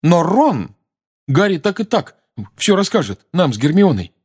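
high, loud protest; boy protesting eagerly; heated exclamation. Acted. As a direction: exclaim it hotly. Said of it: "горячо воскликнул Рон".

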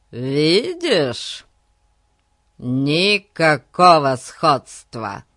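loud, drawn-out triumph; slow, emphatic gloating; triumphant. A narrator's reading. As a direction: crow over being proved right. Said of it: "сказал он с триумфом".

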